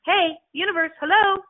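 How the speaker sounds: high, bright sing-song hello to the cosmos; calling out to the universe. Pretend. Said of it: "asking the universe".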